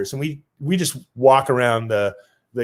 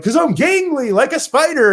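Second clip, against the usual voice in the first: high playful answer; 10 semitones higher, 3 dB louder than usual. Retold or imitated.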